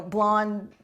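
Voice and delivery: ordinary delivery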